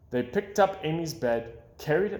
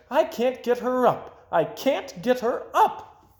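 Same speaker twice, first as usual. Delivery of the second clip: despairing mother; 11 semitones higher, 3 dB louder than usual. A narrator's reading.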